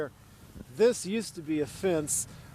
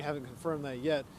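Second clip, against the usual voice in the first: usual speaking voice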